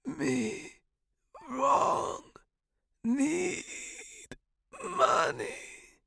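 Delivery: halting, high dying rasp; choked croak. A narrator's reading. Dying professor raising fist to student, choked. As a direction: strain out one word at a time. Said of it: "he choked out".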